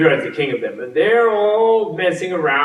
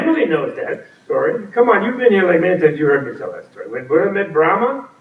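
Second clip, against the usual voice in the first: usual speaking voice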